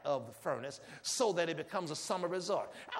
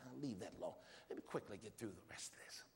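whispered aside; 3 semitones lower, 8 dB quieter, faster than usual. Real, not acted. Quick aside during sermon.

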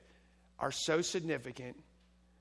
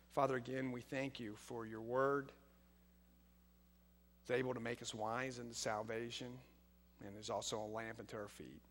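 leading prayer; 2 semitones lower, 4 dB quieter than usual. Real, not acted. Pastor thanking the Father for his word, quiet prayer. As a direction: pray quietly over the congregation, pausing between phrases.

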